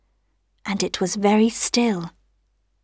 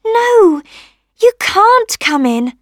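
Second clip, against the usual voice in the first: high, louder little rose voice; rosebud refusing; 11 semitones higher, 6 dB louder, slower than usual. A narrator's reading. Rosebud turning the rain away, high emphatic refusal. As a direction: refuse firmly in a small high voice.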